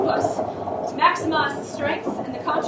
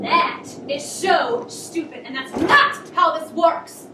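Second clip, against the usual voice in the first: slow exasperated; slower than usual. Acted. Exasperated at the plan.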